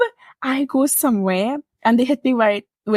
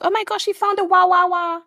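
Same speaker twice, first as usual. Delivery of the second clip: higher mock-excited gossip voice; mock headline; 8 semitones higher than usual. Retelling a silly headline, mock excitement. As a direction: ham up the headline. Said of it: "they were like".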